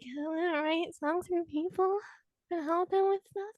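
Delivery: small, high voice, acting out shy nerves; timid reply. Student put on the spot answers the speaker, timid. Retelling nervous pitch when called on.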